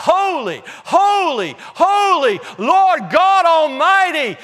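high, ringing cries of worship; loud, fervent preacher's exaltation; crying out. Acted. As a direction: cry each word out like a proclamation. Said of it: "and they're crying out".

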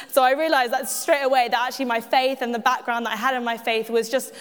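ordinary talk, nothing marked

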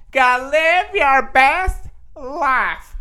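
high, slow, stretched-out slogan; mock motivational quote. Vlogger to viewers, quoting a slogan in a high, slow drawl. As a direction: croon it like a self-help guru. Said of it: "I'm just gonna be quoting everybody".

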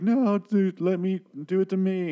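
high, strained imitation of a sobbing plea; a parody of a desperate father's cry; mock crying plea. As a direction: squeak it out like a man breaking down, hammed up. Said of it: "So Rick starts to cry".

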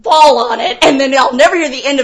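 loud, high comic tirade; exasperated warning. Retold or imitated. Producer warning performer about water breaking, exasperated. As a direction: rant it out in mock desperation.

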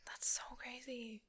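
whispering. Real, not acted. Stunned reaction.

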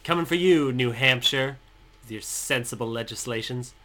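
loud, playful threat that settles into dry mockery. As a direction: call out the state like a wrestler. Calling out New Hampshire.

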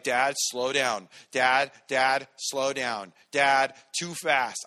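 loud pleading calls of Dad, slowing on too fast; imitating a pleading kid. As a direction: play the scared daughter begging. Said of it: "she's like".

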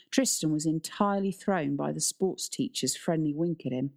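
ordinary delivery

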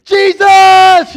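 very high, very loud drawn-out scream. Retold or imitated. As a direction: cry out the name with everything in you. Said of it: "I just screamed out".

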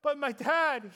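ordinary talk

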